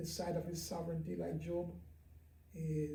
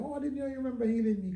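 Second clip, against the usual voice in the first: high, quick breezy reply; fast mock-casual excuse, voice lifted; retold laughing reply; 8 semitones higher, 5 dB louder, much faster than usual. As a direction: act out a light laughing brush-off, pitched up and rushed. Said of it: "just laughing and going".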